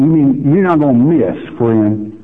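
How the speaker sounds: ordinary talk, nothing marked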